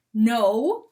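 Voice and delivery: ordinary delivery, nothing marked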